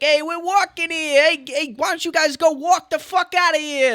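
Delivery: very high, loud East Coast hollering; East Coast accent. Retold or imitated. Re-enacting drunk taunts at Giants fans, shouted East Coast accent. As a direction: heckle strangers in a hammed-up East Coast accent. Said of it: "we do a pretty good East Coast accent".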